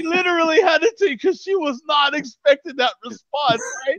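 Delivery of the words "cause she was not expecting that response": ordinary talk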